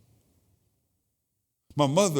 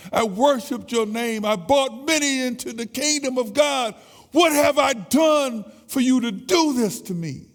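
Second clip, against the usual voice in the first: much higher, louder, crying out to God; anguished shout at God; 11 semitones higher, 7 dB louder than usual. Acted. Grieving preacher shouting his anguish at God, much louder. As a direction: hurl the grief upward, full-throated and higher. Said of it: "He was yelling out".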